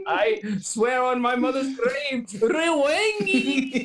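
hammed-up fury at the game maker; mock outraged tweet. Fan tweet ranting at the developer, mock outraged shout. Angry fake tweet read aloud.

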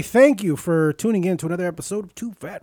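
announcer style; a hint of a radio host voice. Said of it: "this is my radio voice".

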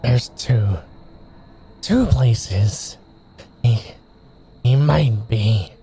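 strained words pushed through clenched teeth; through gritted teeth. Acted. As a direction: grit your teeth and force it out. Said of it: "through gritted teeth".

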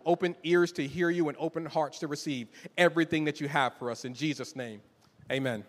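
soft, reverent prayer voice; hushed pastoral prayer. Acted. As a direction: pray it quietly to God. Opening prayer.

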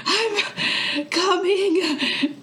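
high, slow breathless call; wheezing reply. Acted. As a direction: call back while out of breath. Wheezing character calls back.

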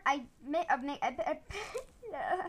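usual speaking voice

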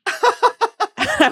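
high laughing "I was like". Real, not acted. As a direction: laugh through it.

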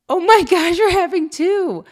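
high, loud, stretched gasp of joy; overwhelmed joy. Retold or imitated. Couple reacting to twins news, high loud amazed joy.

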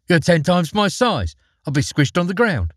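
put-on high, protesting little girl; girl's voice. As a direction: protest like a small child.

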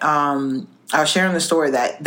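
ordinary talk

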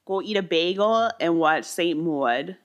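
put-on New Yorker, "Maud" as "Mawd"; New York accent. Co-host teasing like a bagel-eating New Yorker, New York accent. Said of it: "say it like a New Yorker".

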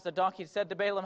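ordinary talk

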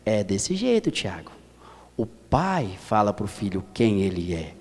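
gentle, deep-set voice of a heavenly father; voice of God. Retold or imitated. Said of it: "aí Deus vem e ministra no coração dizendo".

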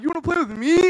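put-on high, loud delighted little girl; thrilled child asking back; little sister. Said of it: "she's like".

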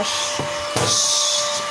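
soft hushing shh; shushing. Real, not acted. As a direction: hush the scared dog gently. Shushing the dog.

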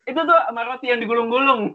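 high, quick mock café order. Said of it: "ngomongnya gini".

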